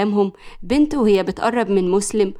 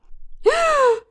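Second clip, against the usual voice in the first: very high indrawn gasp; outraged gasp. A narrator's reading. Woman just threatened by her rival, outraged gasp. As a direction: gasp in mock-shocked outrage.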